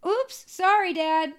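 put-on high little-kid oops; mock-innocent kid apology; sheepish child. Child who just smashed Dad's chest, high sheepish voice.

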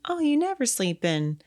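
high, bright-eyed chirp; chipper. Retold or imitated. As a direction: sound cheerfully pleased. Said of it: "all chipper".

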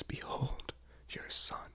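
whispered "Behold, your mother" on a dying breath; pained whisper. A narrator's reading. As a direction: breathe it out like a dying man on the cross. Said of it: "a pained breath".

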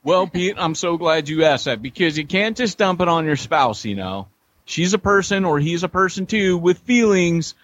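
high, singsong mock sponsor read; hammed-up ad pitch. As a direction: play up a cheesy infomercial answer.

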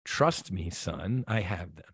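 quiet, fatherly voice of God; gentle God voice. Retold or imitated. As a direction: hush him like a patient father. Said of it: "it was very gentle".

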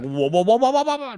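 rising cartoon bonk noise; cartoon sound effects. Imitating cartoons bonking heads, as sound effects. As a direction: make a run of wobbly whoas climbing way up in pitch. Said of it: "doing, like, sound effects".

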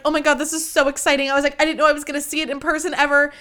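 rushing out the words in delight; excited gush. Retold or imitated. Retold thrill at seeing cantina.

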